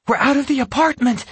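high, fast triumphant cry. A narrator's reading. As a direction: burst out in triumph. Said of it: "he thought triumphantly".

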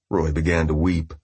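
ordinary delivery

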